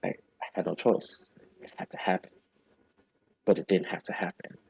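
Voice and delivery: soft, halting mutter; muttering to himself. Acted. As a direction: argue with yourself under your breath. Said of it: "you can hear him muttering to himself".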